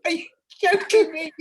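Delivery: high and loud through laughter; laughing disbelief. Retold or imitated. Hearing Maurice hated the British, laughing disbelief. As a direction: laugh it out incredulous. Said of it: "It was like".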